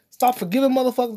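ordinary talk

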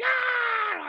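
piercing high scream back at his brother; kid yelling. Retold or imitated. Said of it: "Ari's, like, yelling at him".

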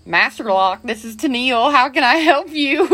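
wife mimics how she answered calls at work, perky receptionist tone; chirpy receptionist greeting. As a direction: put on the cheery office phone greeting. Retelling answering the work phone.

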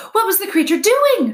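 high, loud, urgent weasel voice; weasel character. A narrator's reading. Weasel questions the owl about the creature, high and urgent. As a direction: squeak the question out high and sharp. Dart the weasel asks crowd.

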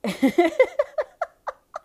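loud high-pitched laugh. Real, not acted. Laughing at own impression.

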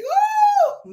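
high whooping cry of praise; ecstatic woo. Real, not acted. Preacher whooping to the congregation, ecstatic high woo. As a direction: let out a joyful woo.

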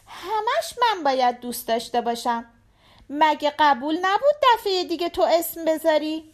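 put-on high little-girl voice, complaining to dad; little daughter protesting. A narrator's reading. (صدای دختربچهٔ معترض)

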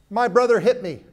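put-on high, loud child voice complaining; whiny child tattling. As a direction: play a child telling on his brother.

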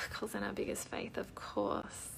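bisikan pelan tanpa suara; whisper. Real, not acted. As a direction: gumamkan seperti untuk diri sendiri. Reading comment under breath.

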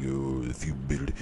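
badly faked narrator voice with an accidental accent; half-hearted celebrity narration, breaking down into blahs; James Earl Jones. Fan attempts the short film's narration, bad impression. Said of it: "James Earl Jones impression ever".

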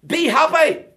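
high, forceful pulpit command; loud exhortation. Acted. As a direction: proclaim it loudly at the crowd. Acting out an exhortation to people.

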